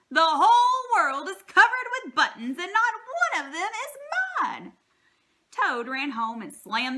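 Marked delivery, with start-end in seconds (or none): 0.1-4.8 much higher, much louder furious scream. A narrator's reading. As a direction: explode in a tantrum. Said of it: "jumped up and down and screamed".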